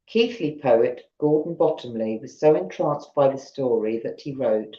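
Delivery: ordinary delivery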